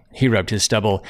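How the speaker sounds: plain reading voice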